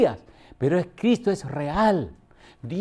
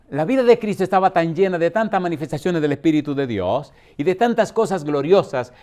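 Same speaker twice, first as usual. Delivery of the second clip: ordinary talk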